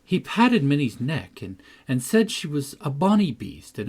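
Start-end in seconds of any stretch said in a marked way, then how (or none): none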